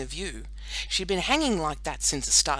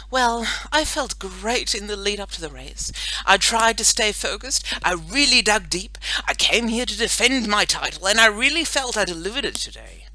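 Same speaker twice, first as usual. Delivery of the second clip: higher, louder, self-assured interview voice; athlete's press conference; 5 semitones higher, 6 dB louder than usual. A narrator's reading. Girl pretends to address TV cameras, proud athlete. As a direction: answer reporters like a proud champion. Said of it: "do my athlete's press conference".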